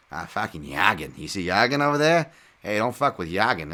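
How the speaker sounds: hammed-up Boston tough talk, R's dropped; Boston accent. Boston local warning others about Yagan, hammed-up Boston accent. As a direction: play a loud Boston local bragging about his fighter. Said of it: "with a Bo-, Boston accent".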